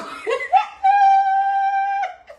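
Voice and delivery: high, loud shrieking laughter; screaming laugh. Real, not acted. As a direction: let out a howling laugh. Punchline of teasing story. (hurlement de rire aigu)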